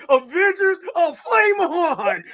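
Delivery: high, loud, stretched-out flame on; mock heroic call. Quoting the hero's catchphrase to friends, high and drawn out. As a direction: call it out like a hero, drawing out flame on. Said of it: "he's gonna say the thing".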